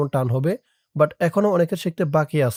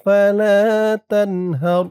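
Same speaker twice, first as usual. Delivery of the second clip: slow, melodic Quran recitation, stretched vowels; drawn-out recitation; 4 semitones higher, 5 dB louder, much slower than usual. Shown as a demonstration. Teacher reads the whole surah aloud for students to follow. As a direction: chant it slowly with long vowels. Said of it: "আমার সাথে বলুন".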